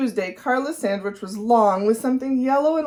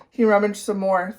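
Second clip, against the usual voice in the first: ordinary delivery, nothing marked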